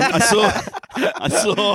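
giggling through the words; laughing. Real, not acted. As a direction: laugh while you speak. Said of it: "laughing while speaking".

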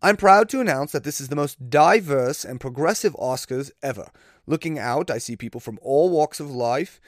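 shaky attempt at a British accent; badly faked British accent slipping toward Australian; horrible British accent. Reading a comedian's imagined Oscars speech, horrible British accent. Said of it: "this is a horrible British accent".

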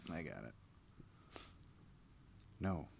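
ordinary delivery

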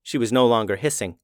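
ordinary delivery

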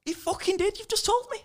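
very high-pitched incredulous protest; exasperated retort shooting up into squeakiness; outraged disbelief. Retold or imitated. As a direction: squeal the comeback in mock outrage. Retold outraged comeback to shopkeeper.

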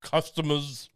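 loud, dopey corporate voice; dumb mock CEO. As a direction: play the CEO as a dimwit. Mocking CEO's corporate speak.

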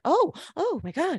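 high, flustered mock gasp of words. Acting flustered woman caught at door.

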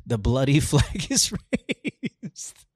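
drawn out with a laugh in the middle; slow, laughing through it. Acted. Reading the bloody anthem lyric to a friend, slow and laughing. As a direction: savour the line while cracking up.

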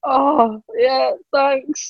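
high, bashful imitated thanks; flustered. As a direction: stammer your thanks like a starstruck fan. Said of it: "Get a bit flustered".

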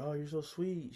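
high, fond imitation of her; high-voiced "aww, how sweet" manner; imitated woman's cooing. Voices her reaction to shyness.

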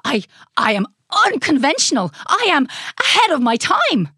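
emphatic, rising protest; indignant mad scientist. A narrator's reading. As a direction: exclaim it with wounded pride. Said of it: "exclaimed the mad scientist".

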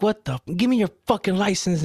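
high, mock-angry demand; cop yelling. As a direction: imitate an irate cop without raising volume. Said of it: "the cop is yelling".

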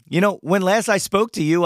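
ordinary talk

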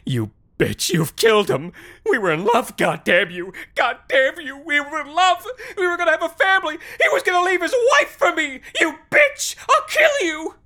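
hysterical screaming, climbing to a shriek; berserk rage. Acted. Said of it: "the nurse screamed".

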